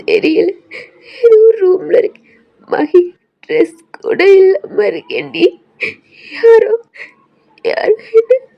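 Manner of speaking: very high, loud tearful crying; sobbing. Acted. Girl tells friend she is without clothes, sobbing. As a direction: break down while speaking.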